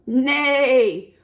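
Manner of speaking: loud, drawn-out neigh; horse's neigh. Acted. Reader voices the horse for a child, loud neigh. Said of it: "The horse".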